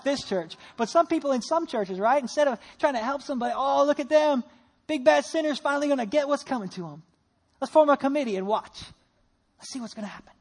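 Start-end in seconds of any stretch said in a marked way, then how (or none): 3.6-10.3 loud, higher-pitched busybody gloating; mock gloating. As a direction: play the judgmental onlookers, relishing someone's downfall. Mocking judgmental churchgoers, acted out.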